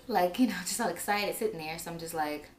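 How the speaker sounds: ordinary talk, nothing marked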